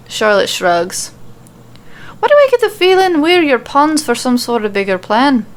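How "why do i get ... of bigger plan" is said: high, loud accusing question. A narrator's reading. Nikki to Charlotte, suspicious of being used, accusing. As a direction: call out her hidden plan. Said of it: "turning to look at her accusingly".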